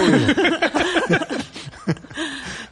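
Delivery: long high-pitched laugh. Real, not acted. Laughing at the quiz joke.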